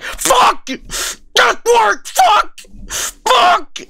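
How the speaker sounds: high, loud victorious yells; triumphant shout. Retold or imitated. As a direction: yell it out like you just won. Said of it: "I'm like".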